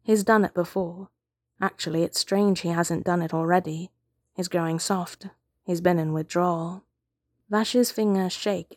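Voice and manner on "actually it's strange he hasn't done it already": plain reading voice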